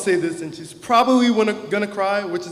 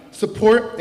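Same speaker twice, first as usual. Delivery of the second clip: normal talking voice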